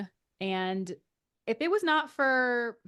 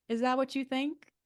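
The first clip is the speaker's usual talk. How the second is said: lightly sassy mock question, a little higher; sassy retort; 4 semitones higher than usual. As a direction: toss it back with a touch of sass, imitating a put-down. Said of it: "So sassy".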